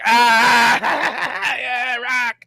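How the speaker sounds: high laughing shout of joy; excited cheer. Retold or imitated. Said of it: "I was like".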